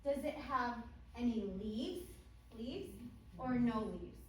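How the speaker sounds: over-enunciate for beginner English learners; kids show host. Shown as a demonstration. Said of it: "But I want to do one with you".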